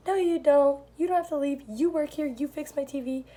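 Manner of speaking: mock yell. Old lady yelling at the waitress, mock yell. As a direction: bark it back like a cranky old lady. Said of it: "she's yelling back at me".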